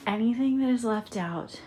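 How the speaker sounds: breathless, panting between words; short of breath after packing. Real, not acted. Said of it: "sorry, I'm out of breath, y'all".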